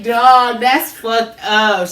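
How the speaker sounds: loud, slow, drawn-out disbelief; disbelieving. Retold or imitated. Telling his friend off over the bathroom story, loud and drawn out. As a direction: stretch it out, stunned and let down.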